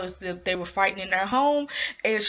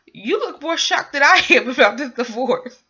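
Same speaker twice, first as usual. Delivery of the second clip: higher, louder, laughing through the quote; 4 semitones higher, 6 dB louder than usual. Real, not acted. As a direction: crack up while quoting someone. Retold quote, she breaks into laughter.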